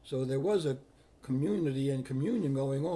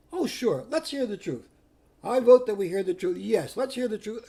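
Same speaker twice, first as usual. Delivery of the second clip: eager agreement; 6 semitones higher, 7 dB louder than usual. Retold or imitated. Another voice.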